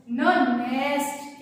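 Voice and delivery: न नेस्ट slowed, the न hummed before नेस्ट; slow n sound demo. Shown as a demonstration. Teacher modelling the n in nest, slowly. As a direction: draw out न, then say नेस्ट. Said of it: "क्या बोलोगे?".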